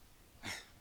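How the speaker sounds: a brief low-key laugh; short laugh. Real, not acted. Sister laughing briefly at the fable comparison, low-key laugh. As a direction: let a small laugh slip out. Sisters share a tender memory.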